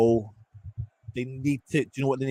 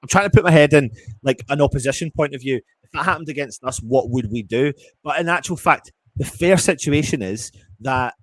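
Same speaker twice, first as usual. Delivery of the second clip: raised, loud and heated; real fan fury, pitched up and loud; angry rant; 4 semitones higher, 6 dB louder than usual. Real, not acted. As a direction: vent the frustration loudly. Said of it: "I'm so angry right now".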